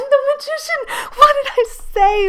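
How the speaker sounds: high, loud laughing cry of delight; excited laughing exclaim. Real, not acted. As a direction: shriek with glee as the prediction comes true. Predicted card appears.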